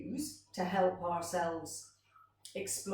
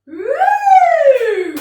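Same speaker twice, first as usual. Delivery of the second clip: very loud, long, high swooping whoop; really high woo; 19 dB louder than usual. Shown as a demonstration. Teacher following a high beanbag throw, very high swooping woo. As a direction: soar up high, hold it, then drop. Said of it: "That was a really high one".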